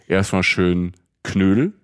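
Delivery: ordinary delivery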